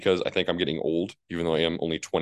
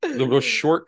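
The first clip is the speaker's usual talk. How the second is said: incredulous; 10 semitones higher, 4 dB louder, slower than usual. Retold or imitated. Exaggerated incredulous self-quote.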